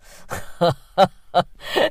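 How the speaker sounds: booming belly laugh; loud guffaw. Acted. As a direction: let out a big guffaw. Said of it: "He lets out a guffaw".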